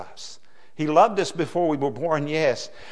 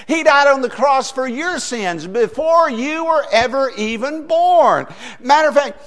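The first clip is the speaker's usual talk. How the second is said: rising, louder preacher's cry, slowed; preaching at full force; 7 semitones higher, 5 dB louder than usual. Acted. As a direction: build the sermon up to a ringing climax.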